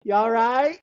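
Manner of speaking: high, laughing imitation of offhand parents; comic high-pitched brush-off; mock casual check-in. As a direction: check briefly, then dismiss it through laughter. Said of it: "it was always like".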